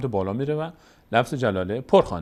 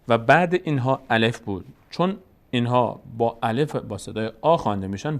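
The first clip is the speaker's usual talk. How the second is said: ordinary talk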